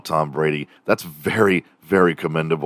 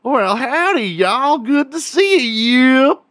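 very high, hammed-up Texan twang; exaggerated Texan accent; 4 dB louder, slower than usual. Caricature of a Texan greeting passers-by, hammed-up Southern accent. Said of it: "people that talk very Southern".

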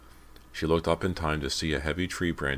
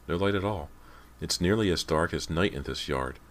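ordinary delivery, nothing marked

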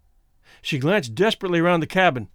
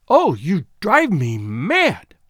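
slow, swooping cry of exasperation; drawn-out, wrathful outburst; exasperated wrath; 4 dB louder, much slower than usual. A narrator's reading. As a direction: draw out each word in helpless anger. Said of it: "wringing her hands in impotent wrath".

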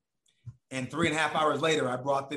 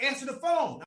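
put-on high girlfriend voice; 11 semitones higher than usual.

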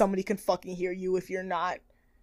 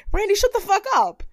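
snapping back; 11 semitones higher than usual. Retold or imitated. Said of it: "just like".

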